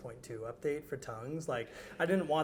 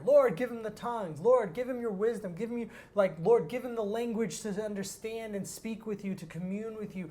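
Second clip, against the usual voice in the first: high, loud fervent shouting of a prayer; retold prayer shouting; 6 semitones higher, 7 dB louder than usual. Prayer group shouting in a boy's ear, loud and fervent. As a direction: call out to the Lord over the crowd, much louder. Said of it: "now they're shouting in my ear".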